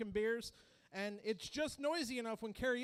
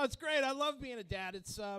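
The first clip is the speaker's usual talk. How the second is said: normal talking voice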